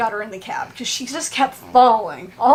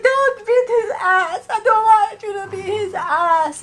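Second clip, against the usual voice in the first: mock crying plea; 8 semitones higher than usual. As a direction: imitate her sobbing plea, pitched up. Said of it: "And she's like".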